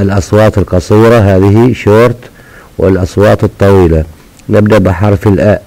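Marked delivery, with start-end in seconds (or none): none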